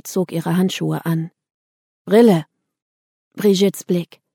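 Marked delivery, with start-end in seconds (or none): 2.1-2.5 one loud, abrupt word of command; curt command. A narrator's reading. Brigitte orders the narrator to put glasses on, curt command. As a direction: bark it as an order.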